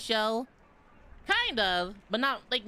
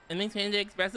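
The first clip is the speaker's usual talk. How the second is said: ordinary talk, nothing marked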